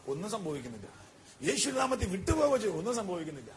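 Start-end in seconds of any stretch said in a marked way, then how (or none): 1.5-2.8 hurried mock command. Disciples commanding devil, fast.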